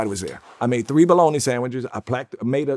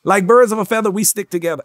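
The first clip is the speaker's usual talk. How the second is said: upbeat ad pitch, a bit louder on the first half; commercial line; 5 semitones higher, 6 dB louder than usual. Shown as a demonstration. Reciting his old Gino's commercial on request, ad read. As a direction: sell the jingle like the Gino's spot. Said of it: "Hit it".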